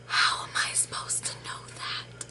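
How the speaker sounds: whispered disbelief; hushed, voiceless incredulity; exasperated whisper. Retold or imitated. Exasperated reaction, first take whispered.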